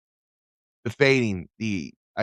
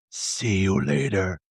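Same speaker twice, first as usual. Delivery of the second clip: deep gravelly voice; 4 semitones lower than usual. Pretend. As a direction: drag it out like a menacing stranger. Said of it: "gravelly voice".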